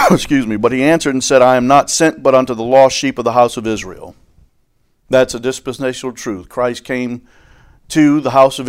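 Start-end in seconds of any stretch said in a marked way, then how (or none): 1.4-4.2 loud, declaiming King James reading; scripture reading. Acted. Preacher reading the Bible aloud to his congregation, declaiming. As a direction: proclaim the verse to the whole hall.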